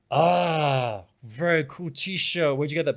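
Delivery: drawn-out "ah", then impressed; mildly hammed-up admiration; retold admiration. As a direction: act out a fan admiring a shirt. Retells musician admiring his T-shirt.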